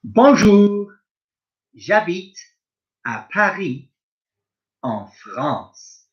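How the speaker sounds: a lightly voiced French speaker, slow and clear; French speaker role. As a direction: play a French person saying where they live, lightly. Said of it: "Here we go one more time".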